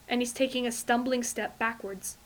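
ordinary delivery

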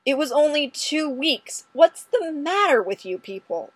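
exasperated yell at the office. A narrator's reading. Detective yelling at coworkers across his desk. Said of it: "and yell".

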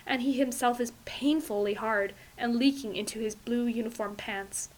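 ordinary delivery

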